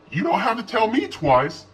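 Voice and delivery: high, eager relieved voice; glad. A narrator's reading. Lizzie glad to leave the house, high eager. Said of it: "sounding glad to be leaving".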